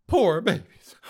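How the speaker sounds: high, loud sarcastic sympathy; mock pity. Host mocking offended islanders with loud sarcastic pity. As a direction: coo it like consoling a spoiled child.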